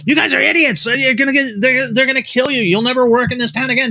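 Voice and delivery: a high-pitched, agitated impression of an angry old writer; Harlan ranting. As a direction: play the panicked mentor blasting warnings. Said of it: "Harlan's like".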